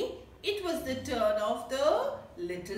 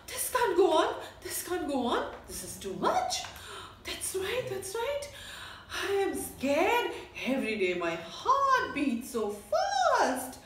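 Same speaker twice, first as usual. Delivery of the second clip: higher, hammed-up frightened animal voices; worried animals; 6 semitones higher than usual. Jungle animals complaining about the lion, mock fear. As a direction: play scared little animals fussing.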